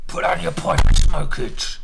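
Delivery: slow gloating shout; loud gloating. Real, not acted. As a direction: crow it out at the opponent. Triumphant taunt over move.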